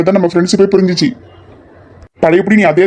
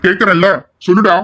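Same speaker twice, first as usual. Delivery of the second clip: usual speaking voice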